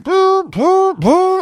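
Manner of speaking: high, loud imitated alarm blaring; mock alarm siren. Russian sailors sounding the alarm, high mock siren. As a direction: blare it like a ship's alarm. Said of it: "So the Russians, like".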